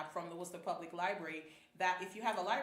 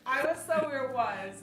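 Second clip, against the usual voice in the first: high, loud, through laughter; laughing; 7 semitones higher, 10 dB louder, slower than usual. Real, not acted. Host starting her airport story, laughing. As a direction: burst into a story while cracking up. Cracking up over the mispronunciation.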